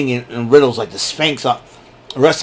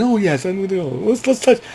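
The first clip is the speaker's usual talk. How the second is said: high soft prissy mock voice; mocking Mansoor; 7 semitones higher, 4 dB quieter than usual. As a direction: lisp it out daintily, mocking.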